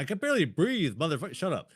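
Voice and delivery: high, exasperated comeback; retold angry protest; mad retort. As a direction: snap back in a high, strained voice. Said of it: "I was pretty mad".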